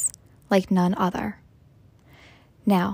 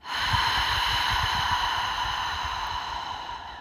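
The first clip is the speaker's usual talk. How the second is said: long audible breath out through the mouth; slow, voiceless cleansing sigh; deep exhale; 5 dB quieter than usual. Shown as a demonstration. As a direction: let a deep breath go in one long rush of air.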